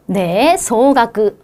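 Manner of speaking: ordinary delivery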